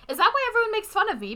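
put-on 2 high, quick, innocent girl's question; 3 raise the voice high and ask fast, wide-eyed; 4 Bridget asking her teacher, high quick girl's question; 1 voicing Bridget. Bridget asks Miss Hadley, voiced.

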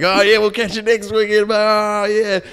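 high, loud bro cheer; hammed-up jock celebration; mock cheering. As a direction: pump it up like a happy weekend soldier. Reserves farewell, high voice.

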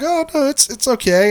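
high-pitched polite brush-off; falsely reassuring. Retold or imitated. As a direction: reassure politely while privately uncomfortable. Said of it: "And I'm thinking, "It's really not okay".

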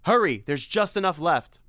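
high loud urging; panicked shout; urgent shout. Acted. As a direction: hurry him along, shouting. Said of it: "cried Simon".

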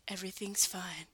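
whispered, weighty movie-dad line; touching, hushed reassurance. Retold or imitated. Movie dad reassuring his little kid, touching whisper. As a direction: whisper it as if it means a lot. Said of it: "make it sound like touching".

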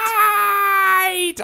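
very high, loud, stretched imitation shriek; long mock wail at full volume; drawn-out mock scream. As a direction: screech the word and hold it, mimicking someone screaming. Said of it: "You really drug out that eight".

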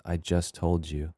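cold creepy. Retold or imitated. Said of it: "the evil way he's like".

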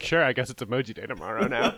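laughing, resigned reply; laughing resignation. Retold or imitated. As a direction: chuckle through it. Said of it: "And I was like".

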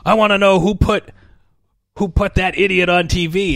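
high, loud mock-furious boss; angry boss. Network head demanding answers, mock angry and loud. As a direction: bark it like an outraged executive. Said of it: "the head of the T V network is like".

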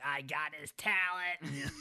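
slow, stretched-out gloating cry; gleeful mock gloat. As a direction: crow over the prize like a cartoon alien. Alien gloating over stolen talent.

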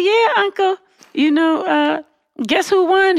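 high, gloating tease; teasing uncle. Retold or imitated. Niece to Republican uncle, high gloating tease. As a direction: needle him high and playful.

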